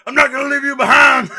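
high, loud heroic movie shout. Pretend. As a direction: yell it like a war film hero. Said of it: "break out the arm voice".

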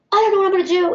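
high-pitched mimicked panic; freaking out. Office woman at a loss over the substitute, mimicked freak-out. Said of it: "And she's freaking out".

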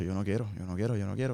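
low, soft repeated refusal; retold quiet reluctance. Man recalls struggling against returning to ministry, quietly reluctant. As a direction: replay an inner protest under your voice.

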